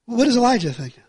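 ordinary talk, nothing marked

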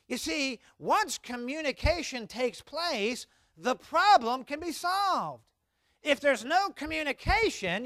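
impassioned preaching. Acted. Pastor urging the congregation to communicate, high and loud. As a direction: drive each word home like a preacher.

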